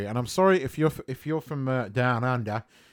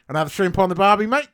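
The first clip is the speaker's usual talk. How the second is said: put-on louder, higher caricatured Australian accent; mock Aussie accent; 5 semitones higher, 6 dB louder, faster than usual. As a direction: lay on a jokey Australian twang. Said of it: "they've all got Aussie accents".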